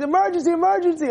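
high, rapid mock alarm; urgent knocker, hammed up; mock urgency. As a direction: raise a playful emergency alarm, fast. Acted knocker interrupts Hillel's shower.

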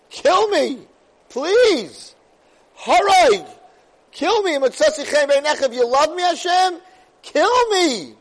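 high, loud desperate cry; desperate plea. Acted. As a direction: beg for it at full voice. Moshe begging for death.

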